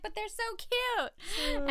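high, drawn-out mock whine on "cute"; cooing protest. Public objects to wildlife agency's warning, mock cooing.

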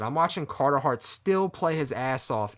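usual speaking voice

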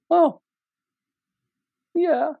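imitated wife's reluctant high squeak; a yes that means no; hesitant squeak. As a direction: squeak it out high, pausing before the yeah. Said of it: "The hesitation, the tone, the squeak".

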